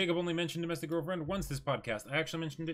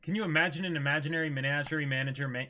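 usual speaking voice

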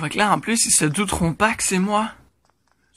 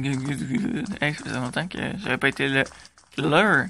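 usual speaking voice

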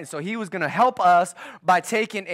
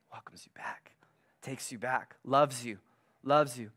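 whispered, low and tender; hushed loving whisper; 5 semitones lower, 6 dB quieter than usual. Real, not acted. Tender description of father.

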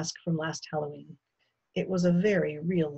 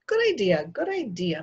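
hearty man voice; 4 semitones higher, 3 dB louder than usual. Acted. Mr Yarby's hearty reply.

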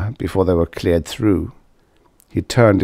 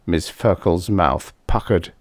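ordinary delivery, nothing marked

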